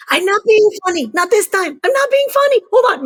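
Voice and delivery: high, loud urgent insistence; frantic insisting. Retold or imitated. Daughter pleading with doubting father, high and frantic. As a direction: insist desperately she's serious.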